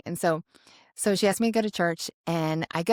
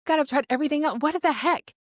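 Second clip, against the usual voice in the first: high-pitched retold protest; exasperated; 9 semitones higher, 3 dB louder than usual. Woman retells pleading with God after breakup, exasperated. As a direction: complain upward in exasperation.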